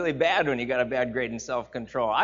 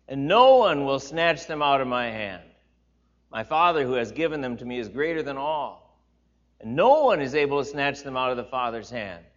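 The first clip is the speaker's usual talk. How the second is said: louder, ringing preaching read; scripture reading; 6 dB louder than usual. Acted. Pastor reading John ten to the congregation, raised and solemn. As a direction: declaim the promise with weight.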